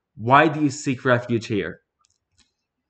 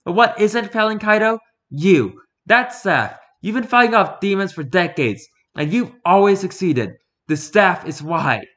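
higher, louder, pressing woman's voice; urgent Fumiko; 9 semitones higher, 6 dB louder than usual. Acted. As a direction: plead hard with him, pitch up.

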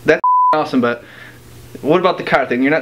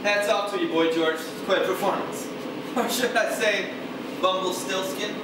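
much softer, sly mockery; smug sarcasm; 9 dB quieter than usual. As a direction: rub it in quietly.

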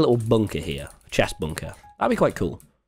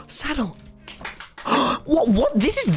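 high gasping delight; excited gasp; 13 semitones higher than usual. Real, not acted. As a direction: gasp and squeal with real joy. Thrilled finding saddle.

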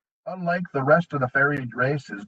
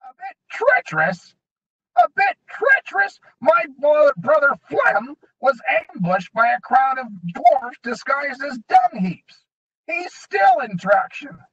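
outraged goblin; 13 semitones higher, 5 dB louder than usual. A narrator's reading.

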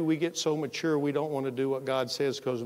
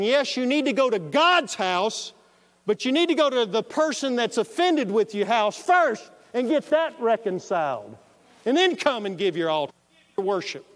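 loud, higher insistent preaching; preaching at full force; 7 semitones higher, 6 dB louder than usual. Acted. Preacher presses the verse's meaning on the congregation, loud and insistent. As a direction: drive the point home to the pews.

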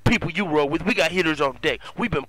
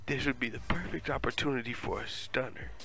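quiet inner thought; 12 dB quieter than usual. Retold or imitated. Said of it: "I think in my head".

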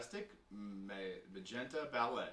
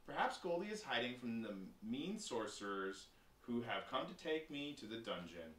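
ordinary delivery, nothing marked

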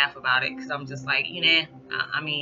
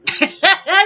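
laughing; 9 dB louder than usual. Real, not acted. Laughing about tiring granddaughter.

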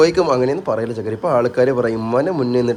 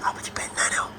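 unvoiced whisper to the baby; whispered baby talk; 13 dB quieter than usual. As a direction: breathe it softly to the baby.

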